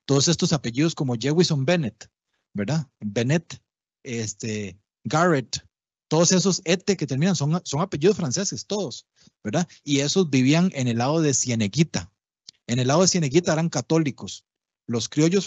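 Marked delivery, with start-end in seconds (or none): none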